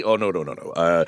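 put-on low-pitched older voice actor, answering; Mel Blanc talking. Mel explaining to Letterman on his show, low-voiced impression. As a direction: imitate an easygoing low-voiced interview guest. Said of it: "he's explaining to David Letterman".

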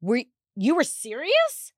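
stunned husband. Retold or imitated. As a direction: caught off guard by a real request. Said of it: "And he's like".

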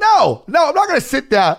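high, heated refusal; retold defiant refusal. Dancer to whole section, retold defiant refusal. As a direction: refuse point-blank, worked up.

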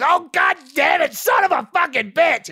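loud, slow furious yelling curse; retold shout at a director; angry shout. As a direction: bellow the curse like losing it on set. Retelling on-set outburst at director.

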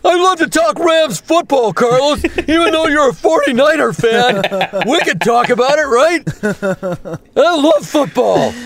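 doing Bill chatting about Rams football, high and goofy. Retold or imitated. Imitating his castmate talking football, high and goofy. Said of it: "He's just such a nice".

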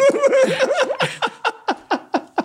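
high burst of laughter; laughing. Real, not acted. Laughing at the joke.

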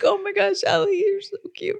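put-on high cooing baby talk; mother cooing at her baby. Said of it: "And I'm like".

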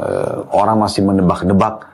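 ordinary talk, nothing marked

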